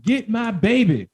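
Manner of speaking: drawn-out high, loud cry; stretched high-pitched yell; shouted plea. A narrator's reading. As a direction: call it out from a window, high and loud. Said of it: "he heard a shout overhead".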